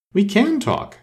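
a haughty flower answering; proud flower. A narrator's reading. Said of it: "said the Tiger Lily".

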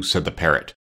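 usual speaking voice

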